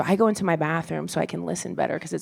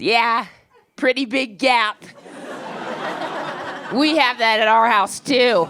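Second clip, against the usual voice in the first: mock landlady; 4 semitones higher, slower than usual. Landlady voiced in a story.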